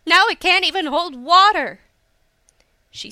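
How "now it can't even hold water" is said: loud, high exclamation of dismay; raised, indignant outburst; frustrated cry. A narrator's reading. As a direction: wail it in exasperation. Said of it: "Hisa cried".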